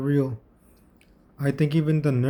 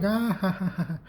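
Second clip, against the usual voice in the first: high mock "ah-ha-ha"; imitated laughter; 6 semitones higher than usual. Showing viewers how they were laughing, high imitation laugh. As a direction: act out their laugh, pitched up. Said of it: "They were laughing like".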